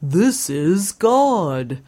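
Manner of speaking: slow, swooping goofy character voice; drawn-out cartoon announcement; invented street voice. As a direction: drag out the words like a dopey street. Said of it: "Lawrence Avenue just pops up".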